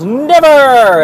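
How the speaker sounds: high, loud sustained never; emphatic never. Retold or imitated. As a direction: shout it out defiantly. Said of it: "Michael Bennett's response was".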